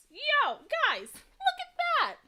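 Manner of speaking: high, drawn-out delight at the page; excited. Real, not acted. Crafter showing viewers her finished page, thrilled and high. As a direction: squeal it out with real glee.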